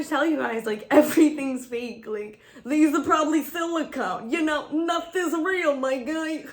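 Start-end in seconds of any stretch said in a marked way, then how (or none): 2.6-6.4 excited outburst. Real, not acted. Ranting that everything is fake.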